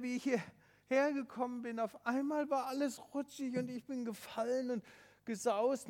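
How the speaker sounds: put-on high, worried child's voice, stranded and hurt; a small boy alone on a ledge, frightened; scared boy Tobi.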